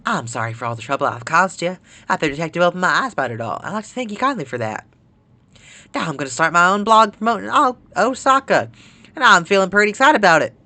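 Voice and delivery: folksy country voice reading a letter; country accent. Acted. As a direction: put on a lively country accent. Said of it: "email from that fellow from Kansai".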